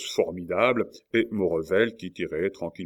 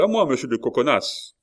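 raised, loud summons; cry for help; 6 semitones higher, 6 dB louder than usual. A narrator's reading. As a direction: call an ally to the rescue. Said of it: "cria la Mole". (appel à l'aide, fort et haut)

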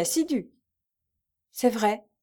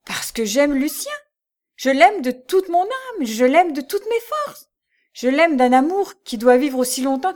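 much higher, loud and impassioned; passionate declaration; 5 semitones higher, 6 dB louder than usual. A narrator's reading. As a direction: pour out your love with fire. Lucie's passionate declaration of love. (Lucie clame son amour, passionnée et forte)

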